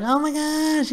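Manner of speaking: squeaky mock wife voice; high-pitched woman. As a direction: mimic her high-pitched gush. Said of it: "you know, high-pitched voice".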